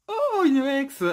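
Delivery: high, loud gasp of discovery; delighted surprise. Real, not acted. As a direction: exclaim high at a lucky find. Surprise at finding an ex card. (surprise ravie)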